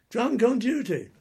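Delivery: loud, high, drawn-out accusation; mock shouted charge. Army superior charging a drinker, loud mock military shout. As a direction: call out the charge slowly at full voice.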